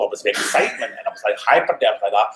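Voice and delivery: usual speaking voice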